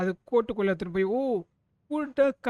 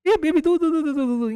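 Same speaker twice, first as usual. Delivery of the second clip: excited calling out; 7 semitones higher, 7 dB louder, faster than usual. Real, not acted. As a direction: call out high and loud, syllables tumbling. Calling out on spotting the baby.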